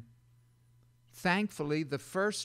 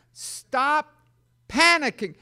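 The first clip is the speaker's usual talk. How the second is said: loud command; 11 semitones higher, 9 dB louder, slower than usual. Shown as a demonstration. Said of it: "They're always panicking".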